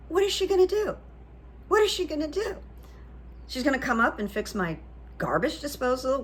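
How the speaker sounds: high, incredulous huffing at the idea; irritated inner voice. Retold or imitated. Annoyed tenant doubting the new manager, high and incredulous. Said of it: "a little irritated".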